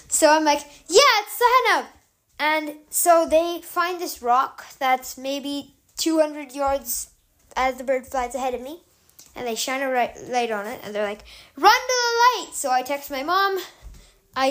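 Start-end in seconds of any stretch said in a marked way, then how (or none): 0.9-1.8 higher, louder shout of her name; calling out. Retold or imitated. Calling back to rescuers. 11.5-12.5 much higher, urgent call; shouted command. Retold or imitated. Rescuers shouting from far.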